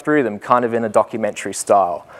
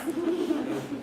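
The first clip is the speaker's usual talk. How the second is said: short laugh; 15 dB quieter than usual. Real, not acted. Laughs at own story.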